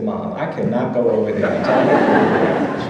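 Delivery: put-on slow, laughing whine of a reluctant kid; boyish protest. A boy laughing as he protests his mother's order, slow. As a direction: drag it out like a boy balking at a chore.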